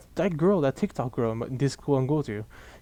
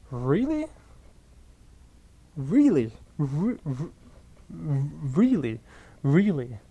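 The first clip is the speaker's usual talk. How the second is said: incredulous; much slower than usual. Real, not acted. Disbelief at teacher's advice.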